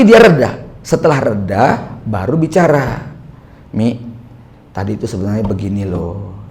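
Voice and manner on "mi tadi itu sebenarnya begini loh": softer and lower, calm talk to the wife after anger has passed; gently explain, all heat gone. Retold or imitated.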